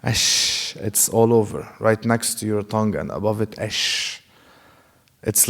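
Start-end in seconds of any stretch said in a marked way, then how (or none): 0.0-0.7 "Ash" whispered with a spreading sh hiss. Shown as a demonstration. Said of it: "breath being spread all over your mouth". 3.6-4.2 "Ash" whispered with a spreading sh hiss. Shown as a demonstration. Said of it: "breath being spread all over your mouth".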